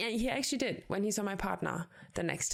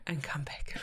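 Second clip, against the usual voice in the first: whisper; 5 semitones lower than usual. Real, not acted. Joking sign-off to listeners.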